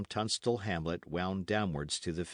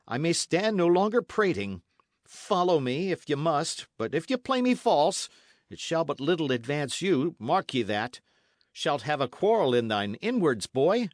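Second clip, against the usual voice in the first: louder, higher stern warning; stern young Dick, louder; Dick, threatening; 6 semitones higher, 5 dB louder than usual. Acted. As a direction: warn him what betrayal costs. Dick warns Matcham.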